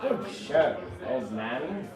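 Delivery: mock posh English accent; lightly put-on English accent, slow. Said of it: "And in English accent".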